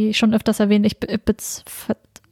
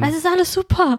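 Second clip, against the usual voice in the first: high, bright fake positivity; mock cheerful; 7 semitones higher than usual. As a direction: chirp it like empty cheer. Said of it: "wenn die ganze Welt einem verklickern will".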